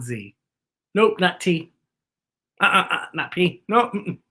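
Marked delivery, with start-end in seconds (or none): none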